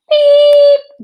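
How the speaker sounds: high, loud whistle blast; shrill whistle sound; whistle beep. Acted. Whistle imitated.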